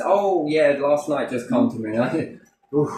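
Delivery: ordinary talk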